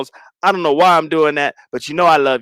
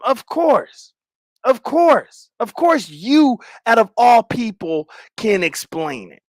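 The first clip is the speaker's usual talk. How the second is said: higher, drawn-out sarcastic "of course"; sarcastic mock agreement; 6 semitones higher, slower than usual. Streamer mocking a chatter who questions him, sarcastic. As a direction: fake agreement dripping with irony. Sarcastic reply to a chat message.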